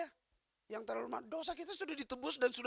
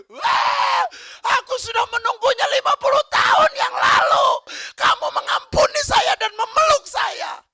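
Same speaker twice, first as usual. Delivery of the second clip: high, loud, tearful wail; husband crying out; 13 semitones higher, 15 dB louder than usual. Retold or imitated. Weeping husband pleading with his dying wife, loud and tearful. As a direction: cry out fifty years of waiting.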